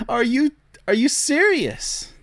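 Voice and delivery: high-pitched, laughing disbelief; incredulous laughter. Real, not acted. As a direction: drag out the question through a laugh, high and slow. Laughing disbelief at the man's claims.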